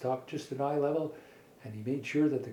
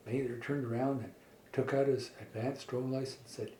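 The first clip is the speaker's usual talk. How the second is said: usual speaking voice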